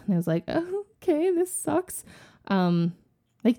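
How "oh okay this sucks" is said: put-on glum teen. Teen self, sent to school.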